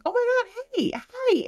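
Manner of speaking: high, squealed hello without extra volume; delighted greeting. Retold or imitated. Guest greeting the manager who helped her, delighted. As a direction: re-enact a thrilled, high-pitched greeting.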